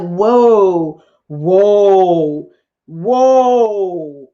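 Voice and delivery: long, loud drawn-out whoa; stunned whoa. Real, not acted. Storyteller reacting to the killing, drawn-out whoa. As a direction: let it stretch in disbelief.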